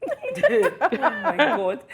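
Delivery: ordinary talk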